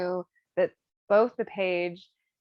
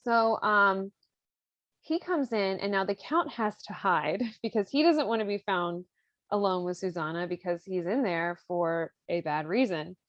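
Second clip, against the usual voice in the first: ordinary talk, nothing marked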